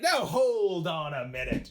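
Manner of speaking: drawn-out American banker drawl; American accent. Acted. British banker objects to the deal, slow hammed-up American accent. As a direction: stretch it out like a mock American capitalist. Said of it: "I have an American accent".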